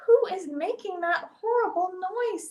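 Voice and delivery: high, exasperated question; indignant. A narrator's reading. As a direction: swoop up in annoyance. Moon demands who makes the noise.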